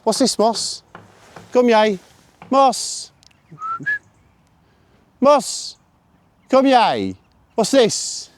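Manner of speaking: high, loud, sing-song calling; bright high calls to bring the dog back; loud high commands to a dog; dog recall calls. Real, not acted.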